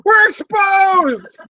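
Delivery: slow put-on voice. Friends joking, stretched slow mock announcement.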